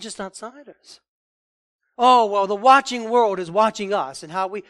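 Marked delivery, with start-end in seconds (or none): 2.0-4.6 loud mock whine. Preacher mocks worried Christians.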